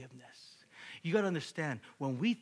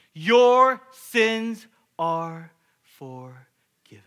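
slow, loud declaration of forgiveness; booming proclamation; 4 semitones higher, 18 dB louder, much slower than usual. Acted. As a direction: proclaim it slowly with full force, like Jesus. Jesus pronounces forgiveness.

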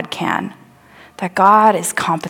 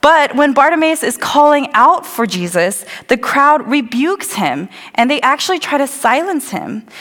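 usual speaking voice

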